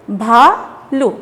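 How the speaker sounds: "भालू" stretched slowly, syllable by syllable; stressed long vowels. Shown as a demonstration. Teacher showing children how to read it, stressed and stretched. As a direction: draw out both halves of "भालू". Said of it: "इस पे जोर दिया".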